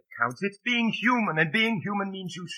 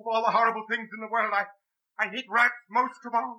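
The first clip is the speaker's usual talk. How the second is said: frightened; 8 semitones higher than usual. Acted. She is terrified of rats.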